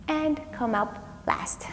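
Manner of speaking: slow whispered cue. Real, not acted. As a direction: breathe the words out softly. Gentle yoga cool-down cue.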